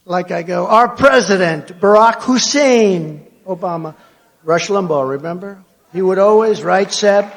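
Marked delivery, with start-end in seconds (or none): 0.7-3.9 slow and loud, "Hussein" drawn out; interjected middle name. Shown as a demonstration. Speaker showing how he interjects a name, "Hussein" stretched loud. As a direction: announce it slowly, hammering "Hussein". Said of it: "when I interject a name".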